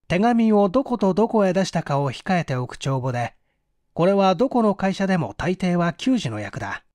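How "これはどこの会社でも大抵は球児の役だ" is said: ordinary delivery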